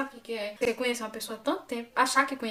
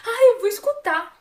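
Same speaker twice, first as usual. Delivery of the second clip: retruque imitado, bem agudo e alto; imitando resposta atravessada; 10 semitones higher, 10 dB louder, slower than usual. Retold or imitated. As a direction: arremedo alto, agudo e arrastado de quem discute. Resposta da mulher na briga. (imitando retruque estridente e esticado)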